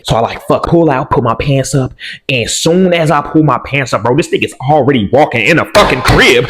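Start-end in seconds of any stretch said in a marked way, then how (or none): none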